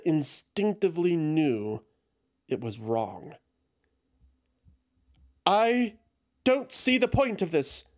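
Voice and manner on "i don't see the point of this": put-on high, whiny protest in a young woman's voice; complain, pitched well up; complaining Eothas. A narrator's reading. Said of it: "complained Eothas".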